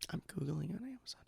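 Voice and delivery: breathy whispered repeat. Shown as a demonstration. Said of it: "whispered repeat".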